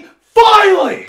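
high, very loud exasperated shout; screaming. Acted. Fan reacting to a long-awaited plot turn, screaming. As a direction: yell it out in overjoyed relief. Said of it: "Smaller room, quiet voice".

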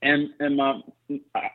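usual speaking voice

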